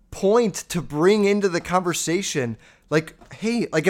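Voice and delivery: normal talking voice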